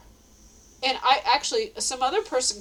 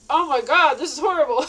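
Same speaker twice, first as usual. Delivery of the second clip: louder, laughing oh my God of disgust; mock disgusted outcry; 5 semitones higher, 8 dB louder than usual. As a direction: cry out at the awful taste, half laughing. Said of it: "he's like".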